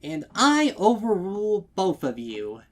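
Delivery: high, loud and beaming; playful authority with a grin; grinning mayor. A narrator's reading. Mayor overruling both customers, beaming loudly. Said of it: "grin growing wider".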